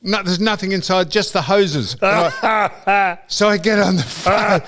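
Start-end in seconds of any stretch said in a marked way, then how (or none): 1.9-4.6 laughing. Real, not acted. Laughing retelling his own blunder.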